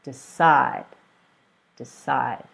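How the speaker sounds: DECIDE with the weight on CIDE; decide said as de-CIDE, falling; second-syllable stress. Shown as a demonstration. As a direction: lean on the last syllable of decide. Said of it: "is on the second syllable".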